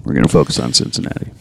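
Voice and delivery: low, fading coach monotone; Bill Belichick impression. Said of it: "Bill Belichick".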